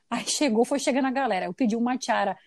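ordinary talk

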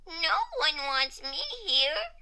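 slow, high, small voice; dejected murmur to self. A narrator's reading. Comma feeling unwanted at school, slow forlorn murmur. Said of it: "he whispered to himself".

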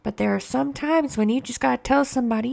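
usual speaking voice

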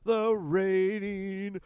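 high, loud, drawn-out hype intro; announcer voice. Pretend. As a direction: sell it like a wrestling ring announcer. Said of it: "bad Queen cover band".

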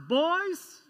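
high calling voice of a father; mock dad calling the boys from across the house; imitating Dad calling. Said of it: "that's how he says it".

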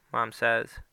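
usual speaking voice

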